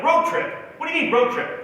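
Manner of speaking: high-pitched baffled comeback; incredulous questioning. Retold or imitated. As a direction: retell it as a disbelieving question. Acts out own puzzled reaction.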